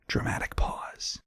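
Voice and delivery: very soft, slow whisper; whispered aside. Acted. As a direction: whisper it like a hushed stage direction. Stage direction read as aside.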